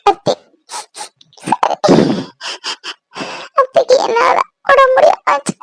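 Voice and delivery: tearful, high-pitched sobbing; crying. Acted. Daughter-in-law blames herself for aunt's illness, sobbing. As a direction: break down in sobs between the words. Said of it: "ஏன் அழுற?".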